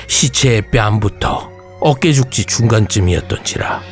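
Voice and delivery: plain reading voice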